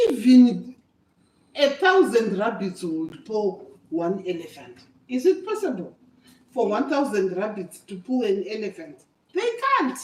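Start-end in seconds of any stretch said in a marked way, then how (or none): none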